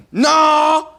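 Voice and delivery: put-on a scared kid trying to sound hard; mock tough threat. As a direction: deliver a threat that comes out wrong. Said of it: "And so I was like".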